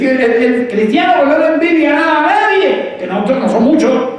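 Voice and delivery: high, loud preaching cry; preaching at full force. Acted.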